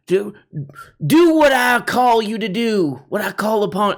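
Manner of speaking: mock general's command, high; hammed-up orders to the troops; Patton. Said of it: "I'm like Patton, telling the troops".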